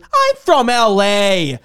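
high, loud, slow whiny complaint; mock entitled rant. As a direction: whine like a spoiled tourist. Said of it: "And he goes".

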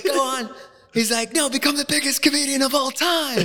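high mock English accent. Quoting Harry's advice in a high English accent. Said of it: "I'm doing an English accent".

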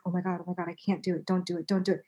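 soft, urgent self-talk, retold; hushed self-restraint. Woman retelling how she fought the urge, under her breath. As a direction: mutter to yourself to hold back.

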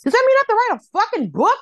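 high, loud, fast mock panic; hammed-up outraged shriek; alarmed disbelief. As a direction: squawk it in horrified disbelief. Acted panic at the news.

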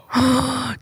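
sharp theatrical intake of breath; mock gasp. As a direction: gasp as if catching out a secret. Mock shock at a slip.

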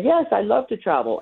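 a hint of an eager woman; woman's eager reply.

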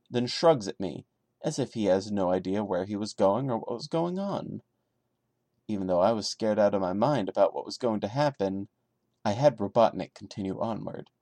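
steady, subdued creepy-story reading; story narration. A narrator's reading. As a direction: read it straight like a campfire tale. Reads creepypasta story aloud.

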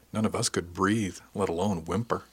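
plain reading voice

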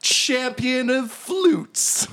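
high, very drawn-out title; Brave Star. Acted. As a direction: proclaim a grand title slowly. Bard finishes his boast.

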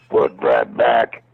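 low, slow threatening voice; menacing growl. Retold or imitated. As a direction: growl it down like a thug. Menacing the Munchkin from car.

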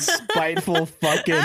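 ordinary delivery

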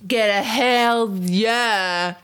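put-on very slow drunken cheer. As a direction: stretch it out like a drunk. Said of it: "In my drunk voice".

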